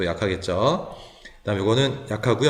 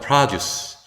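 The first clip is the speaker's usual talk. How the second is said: produce stressed first, "PRAH-duce"; front stress; 3 semitones higher than usual. Shown as a demonstration. Teacher modelling the noun form, produce stressed as PRAH-duce. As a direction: hit the first syllable of produce with open "ah". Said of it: "강세가 있기 때문에 아로 발음하겠죠".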